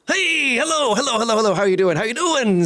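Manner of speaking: high, gushing showman greeting; excitable carnival barker. Acted. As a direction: gush at the newcomers like a barker. Game master plays carnival barker.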